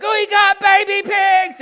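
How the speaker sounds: high, loud, slow cry of delight; excited shout. Retold or imitated. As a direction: shout with joy at the new piglets. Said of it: "I got so excited".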